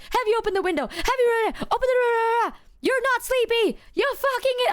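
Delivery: shrill panic, climbing way up; frantic friend. Retold or imitated.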